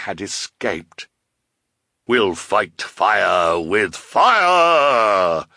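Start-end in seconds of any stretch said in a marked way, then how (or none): 2.1-5.5 very slow, drawn-out shout; triumphant cry. A narrator's reading. Woman carried off by eagles, triumphant shout. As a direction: stretch out a loud villainous battle cry. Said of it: "cried in triumph".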